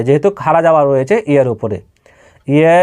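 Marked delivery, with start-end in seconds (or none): 2.5-2.8 "ইয়ে" with its vowel drawn out long; elongated vowel. Shown as a demonstration. Teacher shows learners the long letter, "ইয়ে" held long. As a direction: stretch the end of "ইয়ে" evenly. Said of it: "এখানে ইয়াকে টানতে হবে".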